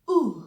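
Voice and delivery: ऊ ending in h; German U. Shown as a demonstration. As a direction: let ऊ trail into breath. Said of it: "ह की आवाज आनी चाहिए". (ऊ के अंत में ह)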